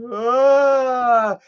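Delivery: imitated drawn-out "aah"; imitated eerie moan. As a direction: draw out an open-mouthed wail. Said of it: "she just kinda does this number here, like".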